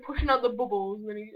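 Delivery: ordinary delivery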